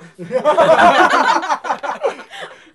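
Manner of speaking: loud shrieking laugh; loud high laugh. Real, not acted. Big laugh at the joke.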